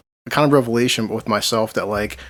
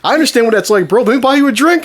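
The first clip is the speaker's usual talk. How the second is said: high, loud chummy voice; outgoing friendly guy; 10 semitones higher, 5 dB louder than usual. Retold or imitated. Matt offering a fellow ex-inmate a drink, high and chummy. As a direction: insist like an effusive drinking buddy. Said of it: "Very friendly guy, very outspoken".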